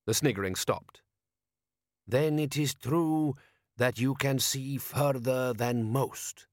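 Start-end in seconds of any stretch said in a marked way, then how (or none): 2.1-6.5 put-on slow, accented, ceremonious elder voice; desert wise man. A narrator's reading. Wise man answers his foreign guest, slow and stately. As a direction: intone it slowly as a foreign sage.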